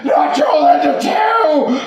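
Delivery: high, loud panicked scream. A narrator's reading. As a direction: shriek it in horror at the sight. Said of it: "screamed Fred".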